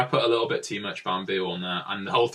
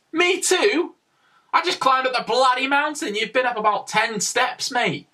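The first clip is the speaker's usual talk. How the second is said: high, incredulous, exasperated comeback; a tired climber answers a kid off the cable car with a high, exasperated retort; mock indignation; 14 semitones higher, 3 dB louder than usual. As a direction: act outraged at the cheek of it.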